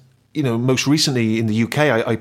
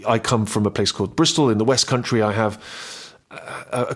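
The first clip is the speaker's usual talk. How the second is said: usual speaking voice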